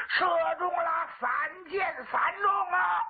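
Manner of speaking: high, sustained cheering call; crowd shouting. Acted. Soldiers cheer three bullseyes, shouting high. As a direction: cry it out high and stretched. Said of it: "两旁边擂鼓呐喊".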